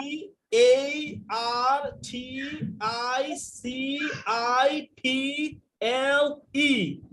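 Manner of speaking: letters spelled very slowly with stretched vowels; exaggerated spelling. Shown as a demonstration. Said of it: "সবাই ঢং করে উচ্চারণ করবেন".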